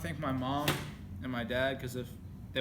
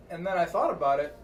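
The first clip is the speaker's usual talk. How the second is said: loud joking; 4 semitones higher, 7 dB louder than usual. Real, not acted. Loud joke, shouting.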